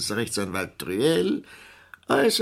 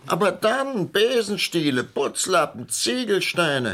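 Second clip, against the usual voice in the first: much higher, outraged list; indignant listing; 11 semitones higher, 3 dB louder than usual. Acted. Complaining to Paulette about the dummy, indignant high listing. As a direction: sound offended by each item.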